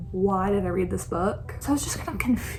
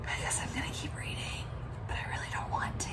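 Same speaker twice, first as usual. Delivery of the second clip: scared whisper; 9 dB quieter than usual. Real, not acted. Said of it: "but I'm just scared".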